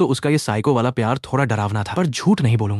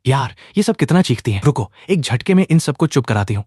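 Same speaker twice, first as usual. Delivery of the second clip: ordinary delivery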